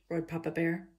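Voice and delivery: plain reading voice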